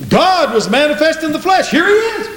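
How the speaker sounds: high, ringing proclamation; preacher crying out at the peak; exultant preaching. Real, not acted. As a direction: announce it joyfully to the whole congregation. Preaches at full force.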